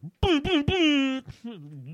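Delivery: loud high laugh; high burst of laughter. Real, not acted. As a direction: crack up loudly. Laughing at own story.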